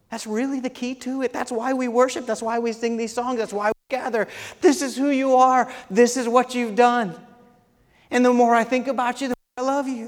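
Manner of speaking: fervent pulpit climb; rising preach. Acted. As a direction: lift the congregation up, building. Preaching build-up.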